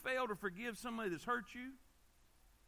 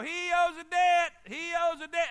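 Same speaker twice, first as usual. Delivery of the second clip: acted public hounding; 7 semitones higher, 12 dB louder than usual. Hired tormentors hound a debtor outside his house, shouting accusingly. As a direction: shout it out like a town crier.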